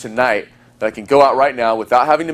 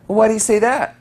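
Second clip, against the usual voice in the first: put-on high curious girl; 10 semitones higher than usual. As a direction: play the girl asking back. Girl's reply in story.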